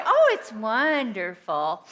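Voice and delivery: loud, stretched-out fake rapture; mock gushing. Parents stuck with a child insisting all is well, mock gushing. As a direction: gush it slowly, overdone and loud. Mocking what parents would say.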